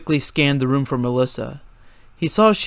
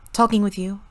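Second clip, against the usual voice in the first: higher, breezy smart reply; cheeky banter; 7 semitones higher than usual. A narrator's reading. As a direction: tease him. Melissa banter, voiced higher.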